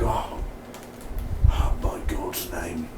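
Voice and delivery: low, slow breath of awe, nearly whispered; imitating Bear. Reviewer imitates Bear's line from the show, low and hushed. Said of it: "how Bear was like".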